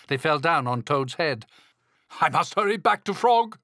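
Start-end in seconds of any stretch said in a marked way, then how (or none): 2.2-3.6 high, loud storybook cry; hammed-up urgency, pitched way up; urgent cry. A narrator's reading. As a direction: cry out in a fluster. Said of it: "he cried".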